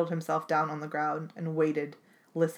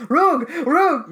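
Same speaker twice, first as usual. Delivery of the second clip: loud high barking Roog; dog's bark cry; 11 semitones higher, 11 dB louder than usual. Acted. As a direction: bark it like a frantic dog. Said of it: "Boris cried".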